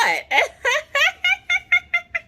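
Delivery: long, high-pitched uncontrolled giggle; helpless squealing giggles on and on; hysterical giggling. Retold or imitated. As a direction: imitate a sedated hysterical laughing fit. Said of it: "I was laughing just like that".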